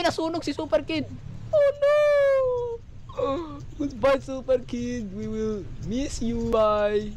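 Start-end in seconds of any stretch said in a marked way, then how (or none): 1.5-1.9 very high, loud fake-crying wail; mock wail. Mock mourning of Super Kid. 2.0-3.7 high-pitched fake crying; hammed-up wailing sob; mock sob. Mock mourning of Super Kid. 3.8-7.1 slow, drawn-out fake grief; mock tearful farewell.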